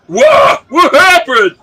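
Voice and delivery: loud, slow startled yell; shocked shout. Acted. Bystanders react to the shooting with a slow startled shout. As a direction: gasp it out loud in alarm. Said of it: "The others are like".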